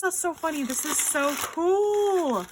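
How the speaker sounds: high-pitched gushing, words stretched out; real high-pitched delight. Real, not acted. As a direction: gush in a high voice, stretching "cool". Delighted by the gift mug.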